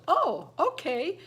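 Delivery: high, drawn-out supportive okay; agreeable husband. Retold or imitated. Voices husband's agreeable reply.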